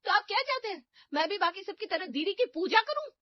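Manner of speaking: shouting at the elder sister-in-law; angry shout. Acted. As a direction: yell at her to stay out of it. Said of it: "Anjali चिल्लाना शुरू कर देती है".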